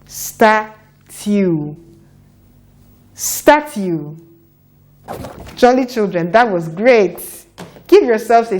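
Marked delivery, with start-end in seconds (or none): 0.1-1.7 "Statue" stretched slowly, sound by sound; drawn-out teaching "Statue"; slow blending. Shown as a demonstration. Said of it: "A bit faster now". 3.1-4.2 "Statue" said quicker as one word; brisk, joined-up "Statue"; faster blending. Shown as a demonstration.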